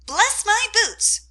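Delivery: high, loud, slow parrot cry; astonished parrot croak. A narrator's reading. Parrot yanked by the tail, astonished squawk. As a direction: squawk it out in surprise. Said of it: "astonished croak".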